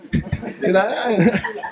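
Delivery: a high burst of laughter. Real, not acted. Laughs at own mock reply. (risa aguda y breve)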